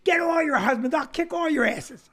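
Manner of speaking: high, shouted mock threat; retold angry challenge. Comic challenges a heckler phoning her husband, retold angry shout. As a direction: call out a rowdy challenge, acting it out.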